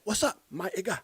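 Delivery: a hint of movie-star impersonation; Jackie Chan. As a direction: mimic the film star copying his buddy's greeting. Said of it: "And then Jackie said".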